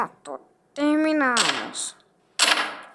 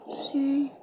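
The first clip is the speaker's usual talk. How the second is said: whispering; 6 dB quieter than usual. Acted. Answering under anesthesia.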